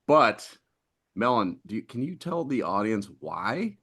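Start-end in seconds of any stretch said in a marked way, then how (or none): none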